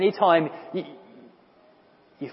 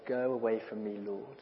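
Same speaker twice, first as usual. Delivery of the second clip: hushed plea; 4 semitones lower, 10 dB quieter than usual. Shown as a demonstration. Preacher corrects to a hushed plea to Jesus. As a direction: go away from me said soft and hushed. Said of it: "No. He said".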